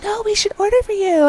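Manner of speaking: very high, insistent mock voice; squeaky exaggerated insistence; annoying girl imitation. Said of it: "as annoying as I'm making her out to be".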